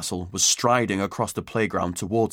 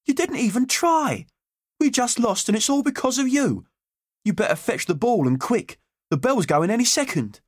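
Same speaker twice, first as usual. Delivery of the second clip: angry schoolboy. Acted. Peter blaming Harry for the lost match, angry. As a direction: blame him hard for the lost game. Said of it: "They were all shouting at him".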